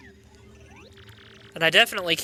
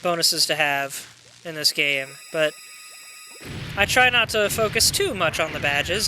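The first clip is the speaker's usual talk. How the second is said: ordinary talk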